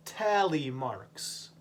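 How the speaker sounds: "tally marks" drawn out slowly, each syllable clear; slow careful "tally marks"; slow model word. Shown as a demonstration. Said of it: "teacher modelling word slowly".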